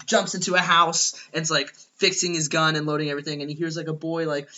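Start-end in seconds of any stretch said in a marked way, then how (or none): none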